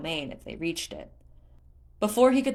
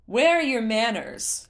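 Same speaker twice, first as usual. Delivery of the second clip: loud, higher slow reproach; mortified scolding; 6 semitones higher, 7 dB louder than usual. Acted. Leto scolds Tula and Groove, mortified and loud. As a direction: scold them, embarrassed.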